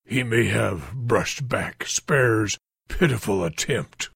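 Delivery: low sneering fiend voice. Acted. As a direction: spit out the scorn. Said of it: "Van sneered".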